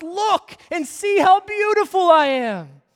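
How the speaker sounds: loud, high proclaiming call; God shouting. Acted. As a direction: call out, inviting them to look. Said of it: "Jesus is God shouting to us".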